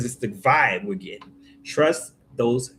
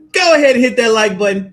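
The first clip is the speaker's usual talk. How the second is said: laughing; 11 semitones higher, 11 dB louder than usual. Real, not acted. Laughing sign-off to viewers.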